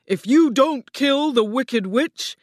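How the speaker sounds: loud, booming warning; threatening Oz. A narrator's reading. Oz threatens Dorothy about aunt and uncle, booming. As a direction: boom the threat with heavy stresses.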